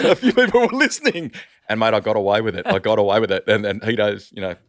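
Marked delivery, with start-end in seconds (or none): 0.1-1.3 higher, louder, laughing through the line. Real, not acted. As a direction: crack up while repeating the punchline. Laughing repeat of punchline.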